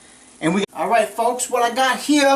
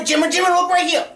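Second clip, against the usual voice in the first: quick, raised call to the cameraman; 6 semitones higher, faster than usual. Real, not acted. Host calls cameraman Jimmy to look, raised quick call. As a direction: hurry him over, voice up.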